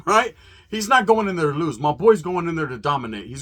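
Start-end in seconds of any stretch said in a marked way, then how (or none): none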